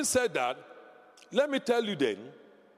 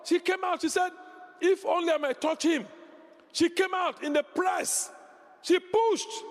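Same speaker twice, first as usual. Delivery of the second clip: full-force preaching; 7 semitones higher than usual. Acted. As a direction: preach it out at the top of the voice.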